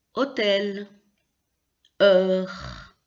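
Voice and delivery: hôtel, heure with no H sound, drawn out clearly; silent H. Shown as a demonstration. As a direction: start hôtel and heure straight on the vowel. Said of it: "On ne prononce pas". (« hôtel, heure » sans prononcer le H)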